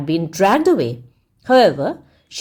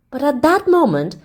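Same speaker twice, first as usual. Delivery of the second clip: usual speaking voice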